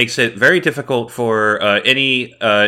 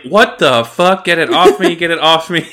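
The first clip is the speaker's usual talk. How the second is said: panicky droid voice; 6 semitones higher than usual. Retold or imitated. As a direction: yelp like a scared droid. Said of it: "the tactical droid's like".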